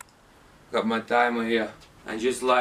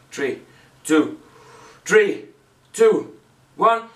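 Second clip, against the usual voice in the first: counting down, getting louder; building countdown; 5 semitones higher, 4 dB louder, slower than usual. Real, not acted. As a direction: count down, rising to a shout. Countdown before chugging.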